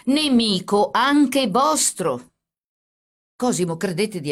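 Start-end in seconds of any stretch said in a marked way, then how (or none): 0.1-2.3 very slow, loud, higher, angry; officer angrily insisting. A narrator's reading. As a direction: hammer each word in anger.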